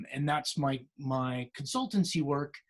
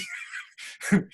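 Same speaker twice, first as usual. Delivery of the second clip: breathy giggle. Real, not acted. Calls the fund his baby.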